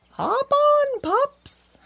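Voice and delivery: very high, loud holler; high swooping cow call. A narrator's reading. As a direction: call the pups aboard, voice way up. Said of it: "hollered Taylor".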